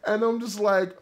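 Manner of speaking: usual speaking voice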